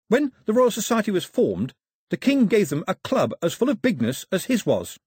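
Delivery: put-on high, careful young voice; earnest islander. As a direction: recite a learned fact to a king.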